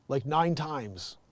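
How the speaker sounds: ordinary talk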